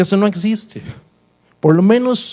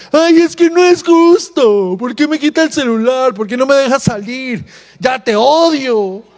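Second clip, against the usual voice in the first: put-on whiny teen yelling; 5 semitones higher than usual. Teen shouting at dad over phone, whiny yell. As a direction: whine like a teenager who lost the phone. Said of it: "te gritaron".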